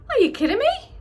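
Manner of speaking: loud, high exclamation of disbelief; real surprise. Real, not acted. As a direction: shout out your astonishment at your own reflection. Shocked at how long her hair is.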